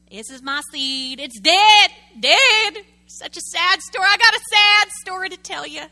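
loud, high mock lament; self-pitying believer. Preacher mocking a believer moaning about a dead seed, loud mock lament.

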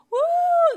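high loud whoop of joy; preacher whooping to the congregation; joyful woo. Real, not acted.

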